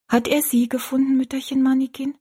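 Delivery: put-on high child's question; questioning little girl. A narrator's reading. Rosalie asks the old woman if she was found, high and childlike. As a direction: ask it high, in childlike earnest.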